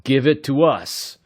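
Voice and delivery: rough, loud command; snapping thug. A narrator's reading. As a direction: bark it as a threat. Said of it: "snapped a strange voice".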